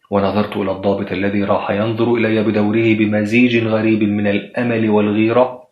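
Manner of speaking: ordinary delivery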